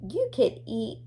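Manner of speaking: ordinary talk, nothing marked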